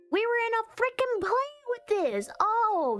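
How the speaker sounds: high, fast burst of recognition; excited realisation. Real, not acted. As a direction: blurt it high as the memory hits. Excitedly recalls being in the play.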